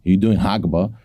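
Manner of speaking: ordinary talk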